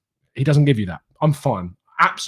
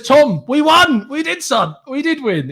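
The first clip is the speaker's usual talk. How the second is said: gleeful; 12 semitones higher, 4 dB louder, slower than usual. Retold or imitated. Reads gleeful fan comment.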